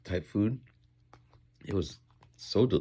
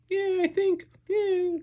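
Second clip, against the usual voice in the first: mock clueless agreement; slower than usual. Mock vague agreement about Kardashians.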